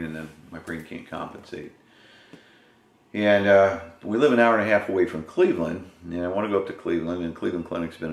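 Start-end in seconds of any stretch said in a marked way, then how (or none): 1.1-8.1 cigar held in mouth; talking around a cigar. Real, not acted. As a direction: hand covering mouth. Seen 0: Hand holds cigar at lips throughout the labelled words.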